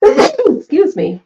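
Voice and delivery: sudden sneeze then excuse me; allergy sneeze, real; sneezing. Real, not acted. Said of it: "guys, so excuse my sneezing".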